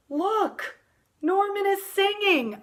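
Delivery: high, eager call to look; excited. Acted. Boy tells the maestro his fish sings, excited. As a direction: point it out with a thrill. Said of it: "I say".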